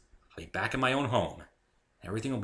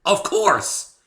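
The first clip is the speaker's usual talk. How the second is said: realization; 12 semitones higher, 13 dB louder than usual. A narrator's reading. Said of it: "more to myself".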